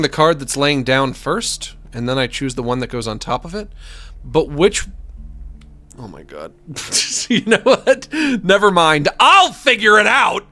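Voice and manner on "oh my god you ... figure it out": high, loud laughing burst; louder, higher frustrated laughter; laughing give-up. Real, not acted. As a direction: shrug it off with a laugh.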